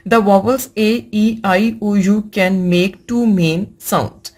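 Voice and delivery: slow reading. Shown as a demonstration. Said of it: "reads rule slowly to class".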